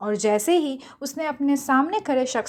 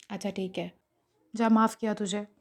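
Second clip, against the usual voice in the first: much softer, melting into forgiveness; softened, giving in; 4 semitones lower, 6 dB quieter than usual. Acted. Juhi forgiving her friend, soft and melting. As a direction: let the anger melt away gently. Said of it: "ये देखकर जूही पिघल गई".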